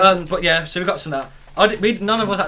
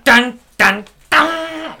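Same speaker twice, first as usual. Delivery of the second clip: dramatic sung sting, rising higher and louder; mock sound effect; 7 semitones higher, 5 dB louder, much slower than usual. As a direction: belt out a homemade suspense sting. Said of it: "we got any sound effects".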